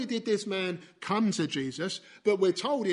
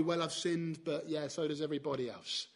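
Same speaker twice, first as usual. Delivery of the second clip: mock shrug; 4 semitones lower, 6 dB quieter than usual. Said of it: "Or are we".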